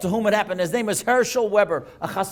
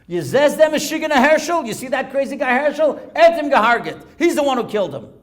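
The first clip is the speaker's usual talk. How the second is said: loud, raised-pitch denunciation; acted accusation; 8 semitones higher, 9 dB louder than usual. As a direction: voice an indignant man in the crowd. Bystander accuses rescuer in the crowd.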